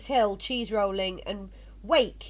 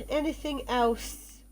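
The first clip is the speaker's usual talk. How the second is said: ordinary talk, nothing marked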